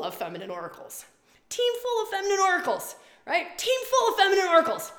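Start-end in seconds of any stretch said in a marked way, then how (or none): 1.5-4.9 high, loud exclaim of the team; 1 excited outburst. Real, not acted. To her audience, loudly thrilled about her team. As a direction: belt it out in delight. Excited echo of own line.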